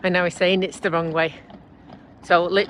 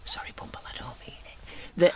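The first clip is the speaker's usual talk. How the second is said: whisper; 6 dB quieter than usual. Pretend. Aside apology to her van.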